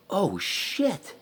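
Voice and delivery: high-pitched stunned exclamation; retold eureka moment. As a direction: let it jump up as it dawns. Retold moment the name pops up.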